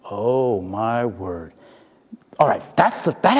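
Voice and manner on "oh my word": very slow, low exclamation; stunned dismay. Retold or imitated. Teacher seeing the biting kid smile, stunned dismay. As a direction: let each word sink, low and drawn out.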